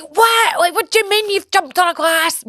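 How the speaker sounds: high-pitched outraged mock dad; incredulous, raging parent, pitched high. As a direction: imitate a dad hitting the roof.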